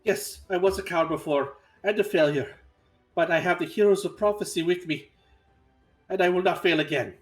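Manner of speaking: defiant declaration. Acted. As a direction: stand tall and shout your resolve. Character defiantly answers the boar.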